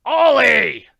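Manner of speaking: loud, high, impatient shout of the name; angry call. Acted. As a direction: bellow the name, losing patience. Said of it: "Ducroy was becoming angry".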